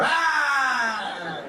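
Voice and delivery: long, high, loud "aah"; hammed-up drawn-out holler; trucker yell. Pretend trucker's yell at coffee.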